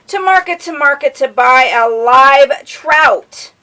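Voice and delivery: sing-song picture-book reading; reading to children. A narrator's reading. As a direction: lilt it for a little listener.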